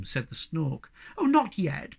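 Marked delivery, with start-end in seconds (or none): 1.2-2.0 high-pitched, pleading protest; begging. A narrator's reading. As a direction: beg not to leave. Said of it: "begged the Snork Maiden".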